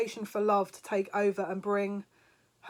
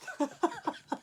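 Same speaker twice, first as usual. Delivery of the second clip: high-pitched burst of giggling; real surprised giggle; 9 semitones higher than usual. Real, not acted. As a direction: giggle breaking out. Burst of laughter.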